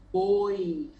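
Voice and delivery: "Oi" with a closed O, like "ôi"; held closed-vowel demonstration of "Oi". Shown as a demonstration. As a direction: keep the O of "Oi" rounded and narrow.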